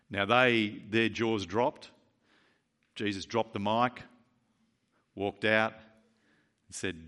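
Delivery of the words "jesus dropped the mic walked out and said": ordinary talk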